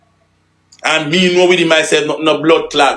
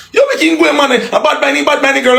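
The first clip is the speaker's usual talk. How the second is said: pitched way up in ridicule; mocking high voice; 11 semitones higher than usual. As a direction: squeak out the tough-guy words to mock them. Mocking the "bad man" posture.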